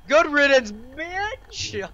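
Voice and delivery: high and loud cheer; gleeful yell. Acted. Friend cheers loudly at the breakup news. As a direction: shout it with glee.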